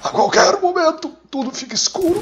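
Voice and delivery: very high, strained hammed-up wail; melodramatic dying. Gamer playing a soldier as all goes dark, melodramatic dying wail. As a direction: overact the last moments.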